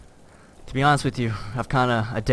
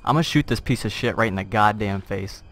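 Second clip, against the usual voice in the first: ordinary talk, nothing marked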